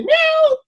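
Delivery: slow, high drawn meow. Retold or imitated. The cat returns next day, a slow high meow. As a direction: stretch out a thin cat's mew.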